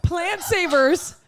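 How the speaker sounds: slow, high, laughing question; laughing disbelief. Real, not acted. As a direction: laugh through the words.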